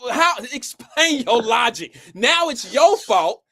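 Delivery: high, loud disbelief; incredulous. Real, not acted. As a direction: push back in disbelief. Incredulous challenge to friend.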